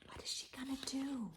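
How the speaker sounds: hushed whisper to the listener; conspiratorial whisper. Real, not acted. Whispered aside to child.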